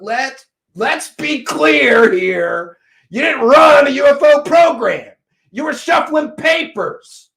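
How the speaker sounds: loud, high, drawn-out emphatic shout; shouting someone down, indignant; angry accusation. Real, not acted. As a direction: lay down the charge at full volume. Angry shouted accusation at Nick Pope.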